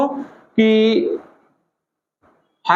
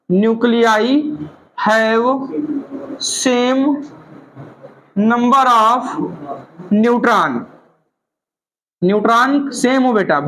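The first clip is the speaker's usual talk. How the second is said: dictation pace; much slower than usual. Shown as a demonstration. Teacher dictating definition for notes.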